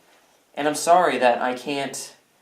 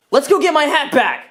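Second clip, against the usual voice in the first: high, excited yell; rallying shout; 9 semitones higher, 4 dB louder than usual. Acted. Rallying friends to recover the hat, high shout. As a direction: shout it like a battle cry.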